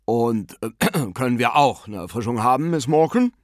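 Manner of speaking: put-on voice with a throat-clear, rising at the end; bearded gentleman. A narrator's reading. Bearded guest asks hostess for refreshment, gentlemanly character voice. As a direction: ask with hesitant, jovial gallantry.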